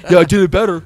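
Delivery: laughing. Real, not acted. Jokes he outdid [name].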